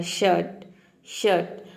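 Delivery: "शर्ट" as "shuht", middle R silent; only SH and T. Shown as a demonstration. Teacher repeats for learners, "शर्ट" without any R. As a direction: sound just SH and T around a short vowel in "शर्ट". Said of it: "केवल आपको एस एच".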